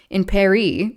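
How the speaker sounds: Paris in an American accent, final S sounded. Real, not acted. Reader slipping out of French after "Français", American accent on "Paris". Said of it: "switched from a French accent to do an American accent saying Paris".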